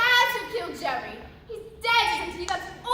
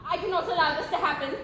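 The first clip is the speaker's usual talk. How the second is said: normal talking voice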